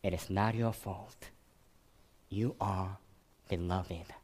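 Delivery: a soft, lightly whispered reassurance. Retold or imitated. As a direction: murmur it close, held in his arms. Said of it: "whispers again".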